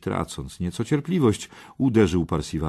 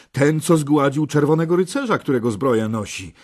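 raised voice of recognition; excited exclamation; 7 semitones higher, 5 dB louder than usual. A narrator's reading. Gawain identifies the knight to his companions, excited exclamation. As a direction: announce the knight's great deed. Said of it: "wykrzyknął nagle Gawain".

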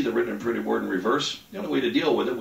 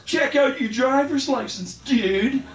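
put-on high, slow, cheeky kid voice; kid brother's voice; 12 semitones higher, 4 dB louder, slower than usual. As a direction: play the nine-year-old landing the punchline. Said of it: "He goes".

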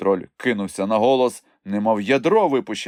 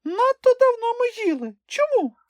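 very high little creature voice; whine-free, squeaky protest in falsetto; squeaky complaint; 3 dB louder than usual. A narrator's reading. Hungry Chmykh questions his companions, squeaky complaint.

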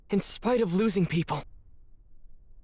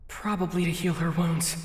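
usual speaking voice

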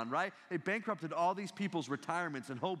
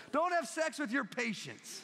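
laughing punchline; 9 semitones higher, slower than usual. Real, not acted. Punchline answer to crowd.

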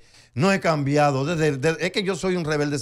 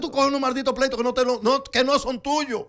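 higher, slower exasperated scold; a friend berating him, pitched up and drawn out; 6 semitones higher than usual. Retold or imitated. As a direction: scold him like a fed-up buddy.

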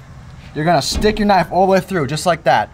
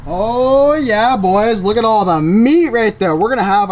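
excited; 4 semitones higher, 4 dB louder, slower than usual. Real, not acted. Pleased with the meat.

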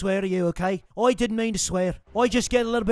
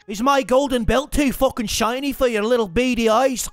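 cocky mock Irish accent, lightly done; a gamer playing brash Irish fighter Conor McGregor; swaggering put-on Irish brogue. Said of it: "you literally sound so fucking funny".

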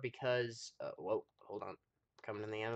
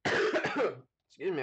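choking cough; 4 semitones higher, 3 dB louder, much slower than usual. Real, not acted. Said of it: "I choked on a piece of rice".

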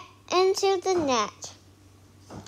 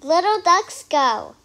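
plain reading voice

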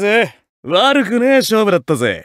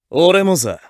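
ordinary talk

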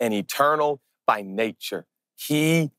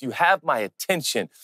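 ordinary delivery

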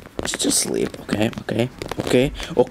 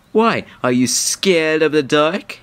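ordinary delivery

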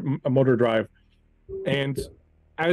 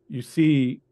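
ordinary talk

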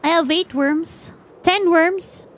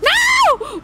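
high, sharp shout of No!; desperate high cry; panicked shout; 13 semitones higher, 3 dB louder than usual. Real, not acted. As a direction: yell to stop him. Character about to eat raw fish.

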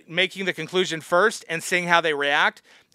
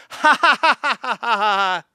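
high, loud mock cackle; jeering put-on laughter at someone's expense; mocking fake laugh; 3 semitones higher, 3 dB louder than usual. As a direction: force out a derisive ha-ha-ha. Acting out an online mocker.

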